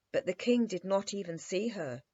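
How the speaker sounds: ordinary delivery, nothing marked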